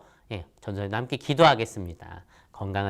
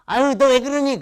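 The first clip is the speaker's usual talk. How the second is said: much higher, louder bashful parent; fond parent; 14 semitones higher, 7 dB louder than usual. Retold or imitated. Mom brushing off her child's love, high bashful coo.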